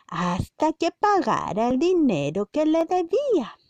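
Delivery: put-on reading a story to children; story narration. A narrator's reading. Narrating the parable for the children, storybook style. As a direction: tell it like a bedtime Bible story.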